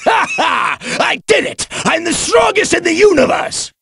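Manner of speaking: high, triumphant shouting; laugh, then crow in victory; gleeful high victory cry; exultant boast. Acted. Victor boasting after a fight.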